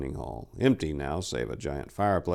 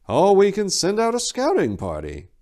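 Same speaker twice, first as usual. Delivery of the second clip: put-on higher, louder old abbot; an old abbot offering a plan; wizened abbot; 14 semitones higher, 7 dB louder than usual. A narrator's reading. Abbot Gelfus suggesting a scouting party, wizened voice. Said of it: "the wizened voice of Abbot Gelfus".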